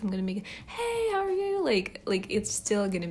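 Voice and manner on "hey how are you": very high, bright customer service voice; chirpy customer service greeting. Shown as a demonstration. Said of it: "if I'm doing my customer service voice".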